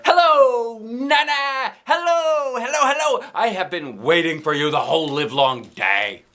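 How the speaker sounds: put-on high gushing child voice; excited greeting. A narrator's reading. Kid hugging Nana, high and gushing. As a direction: gush all over her.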